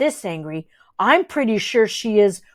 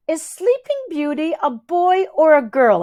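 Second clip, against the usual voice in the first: lightly voiced higher small boy; little brother voice; 6 semitones higher than usual. A narrator's reading. Ridley asks odd question.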